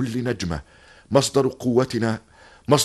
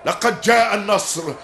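shouted commands; 11 semitones higher than usual. Acted. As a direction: bark orders over the fight. Commander shouting orders in battle.

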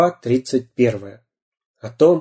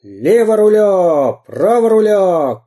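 громкие протяжные команды; кричи команды, как капитан; крик пирата; 8 semitones higher, 4 dB louder, slower than usual. Acted. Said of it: "кричал пират". (пират кричит команды рулевому)

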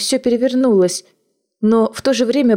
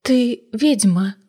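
slow, strange, unsteady whisper of fear; barely recognise your own voice; frightened; slower than usual. Acted. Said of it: "не узнавая собственного голоса".

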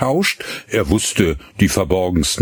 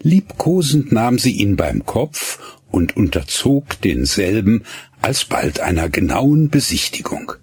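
plain reading voice

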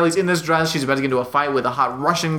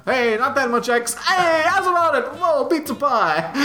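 much higher hammed-up Italian voice, slow on the end; mock Italian tough guy; 10 semitones higher than usual. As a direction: laugh through a cartoon Italian. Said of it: "this dude couldn't look less Italian".

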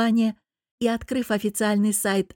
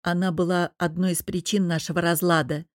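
ordinary delivery, nothing marked

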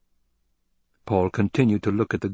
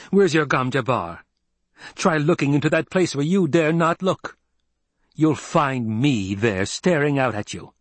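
raised, challenging voice; defiant taunt; 6 semitones higher, 3 dB louder than usual. A narrator's reading. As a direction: throw down a challenge to an enemy. Paul taunts the old woman.

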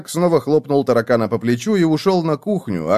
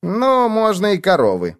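high, indulgent concession; lofty high-voiced assent; gracious prince; 9 semitones higher than usual. A narrator's reading. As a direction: agree condescendingly, pitched high. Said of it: "благосклонно согласился принц".

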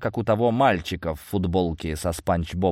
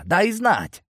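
calling out; 11 semitones higher than usual. A narrator's reading. Hero calls out to search.

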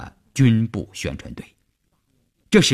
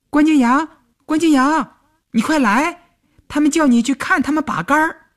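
put-on high, loud child's call through the window; girl calling out; 4 dB louder than usual. A narrator's reading. Little girl calls friend from outside window, high and loud.

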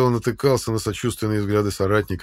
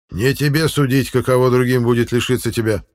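sharp interruption; 4 semitones higher, 6 dB louder than usual. A narrator's reading. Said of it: "довольно резко прервал его Арнвид".

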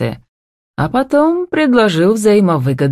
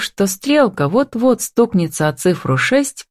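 usual speaking voice

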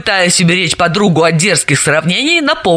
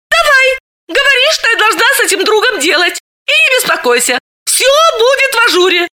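perked up. A narrator's reading. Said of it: "Галина сей же миг оживилась".

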